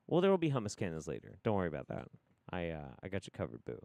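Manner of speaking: ordinary talk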